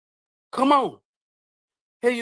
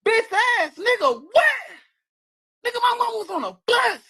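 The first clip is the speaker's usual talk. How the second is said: real angry outburst; 9 semitones higher than usual. Real, not acted. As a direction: explode at the critics in real rage. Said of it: "Why I'm mad?".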